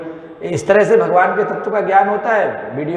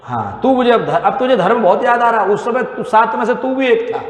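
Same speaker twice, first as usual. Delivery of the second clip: scolding rebuke; 7 semitones higher than usual. Acted. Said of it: "तो भगवान और डांटे".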